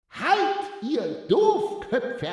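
high, slow, drawn-out spook voice; bellowing ghost. A narrator's reading. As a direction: wail the command like a ghost. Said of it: "brüllte das Gespenst".

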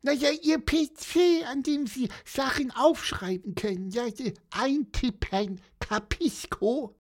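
high bird voice with an impatient groan. Acted. Little bird groaning to policeman about the typewriter, high impatient voice. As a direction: moan at the slowness, chirpy and high. Pieper's voice.

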